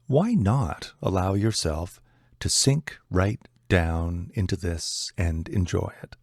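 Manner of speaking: normal talking voice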